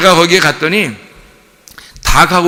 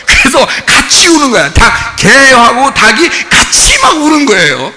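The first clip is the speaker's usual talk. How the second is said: raised voice; 7 semitones higher, 5 dB louder than usual. Real, not acted. Preaching climax about howling animals.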